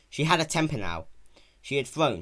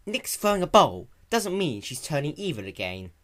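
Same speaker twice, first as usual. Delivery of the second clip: higher, louder, refined lecturing; Rarity lecturing Spike while pinning a hem; 6 semitones higher, 5 dB louder than usual. A narrator's reading. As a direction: correct him gently but firmly. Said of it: "Rarity lectured".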